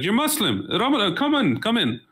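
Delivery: high, delighted surprise, waving him in; excited welcome. Retold or imitated. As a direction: pitch jumps up in eager welcome. Swedish host welcomes fasting guest.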